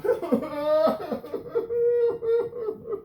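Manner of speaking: high breaking laughter. Real, not acted. Comedian laughing at his own FBI joke, real laughter. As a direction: crack up at your own line. Comic cracks up at FBI line.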